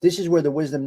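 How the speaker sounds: ordinary talk, nothing marked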